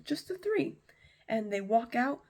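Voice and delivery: plain reading voice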